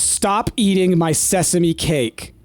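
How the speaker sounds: normal talking voice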